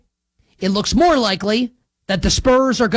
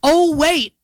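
higher hammed-up "oh, wait"; mock surprise; 7 semitones higher than usual. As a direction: fake sudden realisation. Fake realisation after setting up soundbite.

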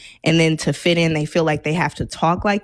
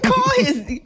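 ordinary delivery

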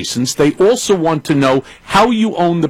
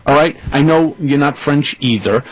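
ordinary talk

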